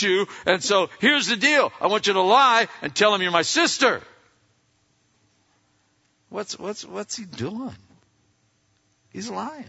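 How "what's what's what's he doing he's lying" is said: hushed aside. Retold or imitated. Aside mocking Abraham's lie.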